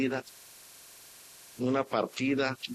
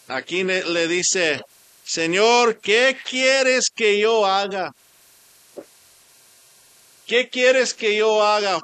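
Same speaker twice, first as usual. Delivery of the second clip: preaching at full force; 6 semitones higher, 6 dB louder than usual. Acted. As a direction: call out like a preacher on fire. Preaching the journey.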